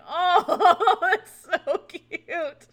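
high, loud, laughing squeal; gushing coo. Retold or imitated. Patient hears of baby's first babysitter, gushing.

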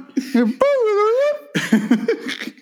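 big high cackle; high cackling laugh. Real, not acted. Laughs at own joke.

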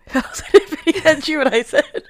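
high, loud, laughing. Real, not acted. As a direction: giggle through it. Laughing mock repeat.

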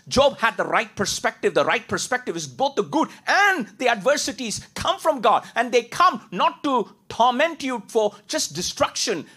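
high, loud preacher's emphasis; fervent preaching. Acted. Preacher explaining Job's perspective, high and loud. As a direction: lift it and push it out.

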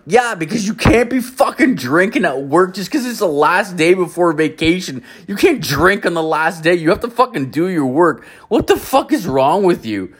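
high, scolding mock rant; exasperated mock scolding. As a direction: chew her out, imitating the boss-like tirade. Berating coworker for drinking at work.

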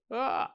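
high mock cry of fright; short hammed-up gasp of fear; startled cry. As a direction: yelp as if an angel just appeared. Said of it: "de eerste reactie van iedereen".

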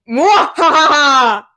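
evil villain cackle; hammy slow evil laugh; sneering panto villain. Pretend. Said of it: "Ew, what was that?".

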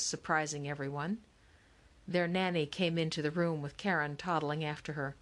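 plain reading voice

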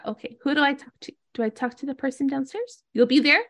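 high, quick little creature voice; small creature checking in, fast; Donkey. Acted. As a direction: ask eagerly in a high critter voice. Voices Donkey asking questions.

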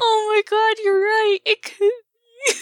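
very high, slow, weepy gushing; overwhelmed crying. Acted. Friend acts overcome with joy at the kid, high and weepy. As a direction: gush through happy tears.

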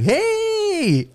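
high, drawn-out "hey" on arrival; excited greeting. Retold or imitated. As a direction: call out a cheery hello, stretched high. Arriving, calls out to cousins.

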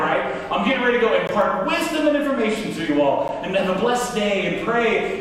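ordinary talk

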